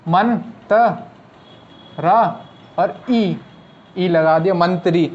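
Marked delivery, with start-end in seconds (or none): none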